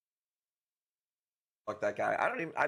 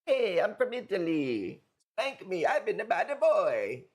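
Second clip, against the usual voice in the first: lightly put-on Italian accent, higher pitch; mock Italian; 5 semitones higher than usual. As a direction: tease him with a cheesy Italian voice. Mocking an Italian man.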